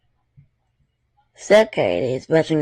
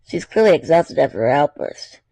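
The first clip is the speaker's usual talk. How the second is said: plain reading voice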